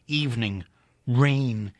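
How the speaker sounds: plain reading voice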